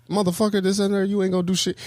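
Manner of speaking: high, fast mock tirade; cussing someone out. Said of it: "cussing out an older dude".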